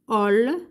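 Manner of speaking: "ol" with O first, closing on L; syllable "ol". Shown as a demonstration. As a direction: sound out "ol" apart, the L at the end. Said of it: "ماشي لو". (syllabe « ol » détachée, L final)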